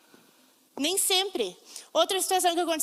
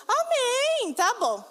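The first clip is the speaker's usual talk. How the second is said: eager amen; 9 semitones higher, 3 dB louder, much slower than usual. Retold or imitated. Accepting pastor's hard word.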